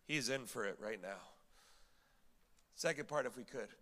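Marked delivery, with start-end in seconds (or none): none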